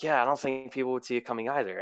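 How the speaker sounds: quick, light echo of her; mimicking Colleen. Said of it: "And then she's like".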